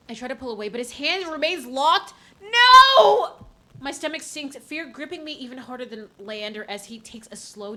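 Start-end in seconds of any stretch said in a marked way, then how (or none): 2.4-3.5 loud high scream; panicked scream. Real, not acted. Hand locked in game.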